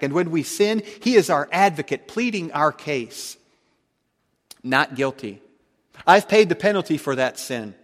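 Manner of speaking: loud, rising preacher's cadence, punching each contrast; fervent preaching. Acted. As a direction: preach it out full force to the congregation. Builds a fervent preaching climax.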